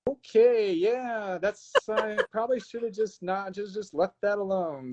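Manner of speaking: high, slow, embarrassed mock voice; drawn-out sheepish backpedal; sheepish Mark. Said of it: "And so Mark's just like".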